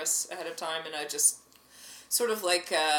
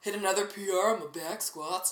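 ordinary talk

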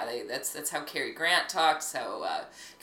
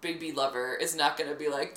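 ordinary talk, nothing marked

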